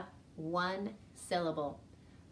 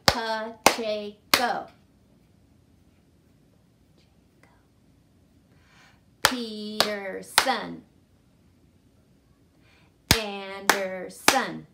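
slow, loud names beat by beat; slow clapped syllables; 6 dB louder, much slower than usual. Shown as a demonstration. Teacher saying staff names slowly for counting. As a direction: give each syllable its own slow beat. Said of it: "listen to a few more".

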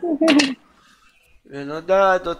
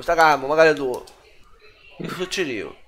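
ordinary delivery

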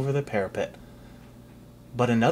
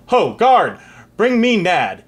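loud royal call to the guard; 6 semitones higher, 7 dB louder, slower than usual. Acted. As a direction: call out across the hall. King calls his guard.

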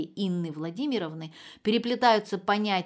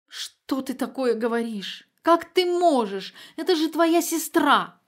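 rising to a much higher, louder wail, slow; sobbing outburst; 7 semitones higher, 7 dB louder, slower than usual. A narrator's reading. Mother wailing at her daughter's callousness, sobbing. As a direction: break into tearful wailing. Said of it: "зарыдала Инна Владимировна".

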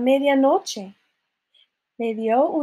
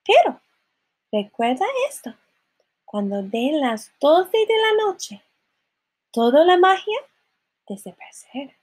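mildly dramatic, building warning; warning godmother; 5 semitones higher, 3 dB louder than usual. Acted. Godmother warning of midnight, mildly dramatic build. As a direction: build louder and higher, then drop away.

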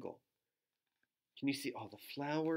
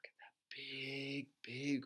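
slow awed whisper; hushed awe; 7 dB quieter, much slower than usual. Acted. Showing children a big whale, whispered awe. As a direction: whisper it in wonder.

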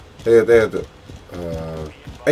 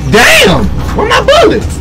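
1 mock surprised yell; slower than usual. Gamer imitating himself finding his clip empty, mock shocked yell. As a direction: play yourself caught with an empty clip. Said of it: "just start shooting and be like".

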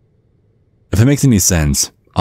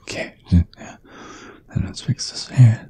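slow, drawn-out whisper; creepy whisper; 7 semitones higher, 5 dB quieter, much slower than usual. Acted. Dad tidying up the date's neck, slow whisper. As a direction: murmur it to yourself while you work.

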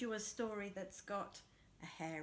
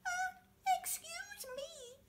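much higher, small timid voice; tiny voice; slower than usual. Acted. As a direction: squeak it up from below. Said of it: "said a tiny voice".

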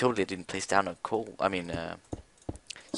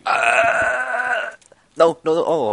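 panicked cries; 14 semitones higher, 9 dB louder, much slower than usual. Real, not acted. Panic in game.

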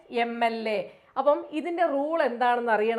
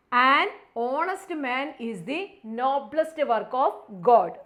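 very slow answer reading; slow read-aloud; much slower than usual. Shown as a demonstration. Teacher reading the answer slowly to students. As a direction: read each word out slowly. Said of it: "reads answer slowly".

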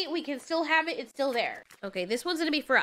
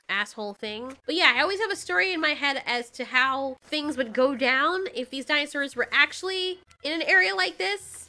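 ordinary talk

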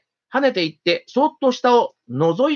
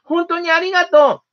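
high little mouse voice, relieved; a small grateful mouse, much higher; 8 semitones higher than usual. A narrator's reading. Mouse thanks Warton.

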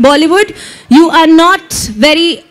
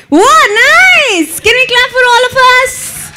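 high, delighted whoop to the crowd; excited cheer; 8 semitones higher than usual. Real, not acted. Host praises the crowd's greeting, excited cheer. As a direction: cheer them on in a high, lifted voice.

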